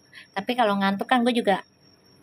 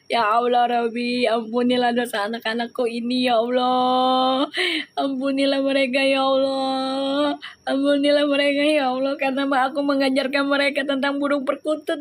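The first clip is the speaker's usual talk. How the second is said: mock prayer. Mock prayer for her viewers.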